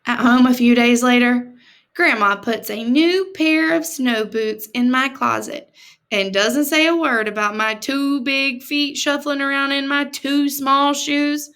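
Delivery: plain reading voice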